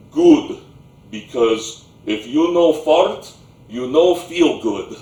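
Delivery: put-on loud Slavic-accented grandpa in broken English; booming Slavic accent. Grandpa approving of the boy's nod, booming Slavic accent. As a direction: pronounce it loudly with a thick Slavic accent. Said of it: "thick Slavic accent, that booming voice".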